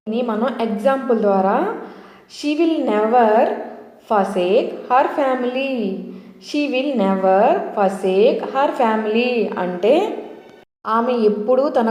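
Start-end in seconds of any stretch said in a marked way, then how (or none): 2.4-9.6 slow example reading. Shown as a demonstration. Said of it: "reading an example sentence slowly".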